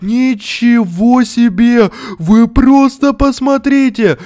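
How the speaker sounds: high, loud and slow amazed shout; loud, slowed-down amazement at the new craft; excited shouting. Real, not acted. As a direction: hype it up for the viewers, loud and high.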